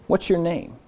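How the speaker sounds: soft, tender asking, falling; gentle question. Acted. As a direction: ask it kindly. Jesus asking, repeated.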